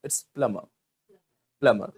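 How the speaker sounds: plumber with silent b and no r, said plumma; right way. Shown as a demonstration. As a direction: drop the b and r in plumber. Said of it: "बी ... silent होता है". (plumber बिना बी और आर के)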